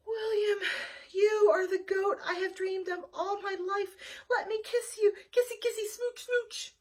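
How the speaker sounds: high, weepy swooning goat voice; gushing lovestruck goat. Acted. Goat Nina swooning over William, high and weepy.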